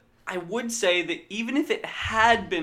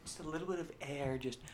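ASMR voice; 15 dB quieter, faster than usual. Pretend. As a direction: soothe him softly, ASMR style. ASMR soft voice.

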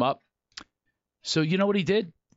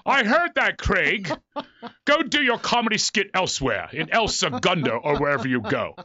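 Patrick Stewart; 6 semitones higher than usual. Retold or imitated. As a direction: scold like an offended British stage star. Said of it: "his bald head pops out".

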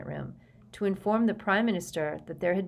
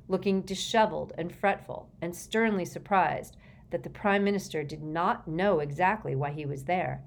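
ordinary delivery, nothing marked

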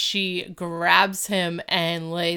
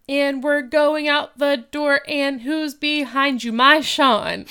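loud, raised mock marching call; marching bravado; 5 semitones higher, 6 dB louder than usual. Boy leading his friend out the door, loud mock bravado. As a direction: imitate a kid proudly leading a march.

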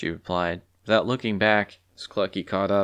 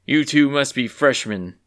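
loud, scornful jab; dismissive scoff, raised voice; 5 semitones higher, 6 dB louder than usual. A narrator's reading. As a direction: scoff at them loudly. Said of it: "he scoffed".

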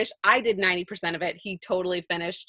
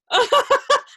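a sudden high giggle, pitched way up; high burst of laughter; 6 dB louder than usual. Real, not acted. Teasing her dad about pins, high laugh. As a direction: laugh out at your own joke.

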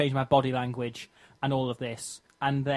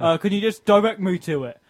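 higher, louder imitation of a driver; pizza delivery guy; 6 semitones higher, 8 dB louder than usual. Lost driver phoning the customer, imitated delivery-guy voice. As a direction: mimic a delivery man asking for help. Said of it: "then says".

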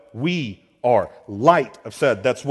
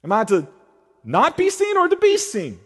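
much higher and louder, mock-exasperated; exasperated questioner; 13 semitones higher, 7 dB louder than usual. Preacher voicing his own confused question to Jesus, mock exasperated. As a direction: throw up your hands at Jesus in mock confusion. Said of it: "I'm like".

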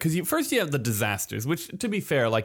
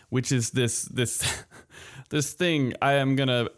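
ordinary talk

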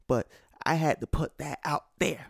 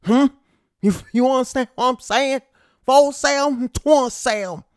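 loud and high, bragging hard; loud, fast Southern-flavoured brag; loud pride; 10 semitones higher, 10 dB louder than usual. Shown as a demonstration. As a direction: boast it out loud to the fans. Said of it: "I'm saying that with some pride".

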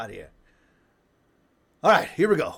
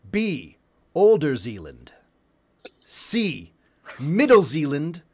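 game show host; 4 dB louder than usual. Acted. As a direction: hype the question like a TV host. Reading a quiz question as host.

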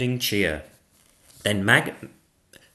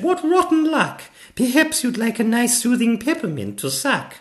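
put-on high, loud sympathetic child; little girl; 6 dB louder than usual. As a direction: commiserate in a little girl's high voice.

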